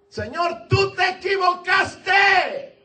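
loud, high, slow cry to the Lord; voiced protest. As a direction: hammer out the complaint at God. Moses' objection voiced, shouted. (Moisés protestando a gritos)

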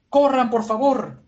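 high, slow, loud plea to flee; pleading shout. Acted. Igaram shouting at everyone to run, high slow plea. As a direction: yell at them to run.

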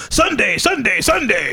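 high, loud hype announcer; monster truck ad. Retold or imitated. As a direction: yell it like an arena promo. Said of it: "old monster truck commercials".

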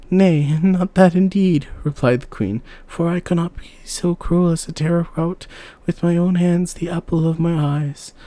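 a mother recoiling at the idea; high, firm refusal; horrified queen. A narrator's reading.

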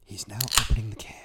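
hushed ASMR whisper. Acted. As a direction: whisper it like a golf commentator.

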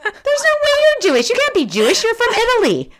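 very high-pitched, loud incredulous protest; loud disbelief. Retold or imitated. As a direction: squeal in utter disbelief. Retold disbelief at boyfriend's claim.

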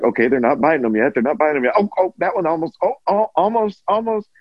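quick, mounting suspense, ending high; tense movie watcher. Retold or imitated. Viewer reliving waiting for the lion bites, mounting mock panic.

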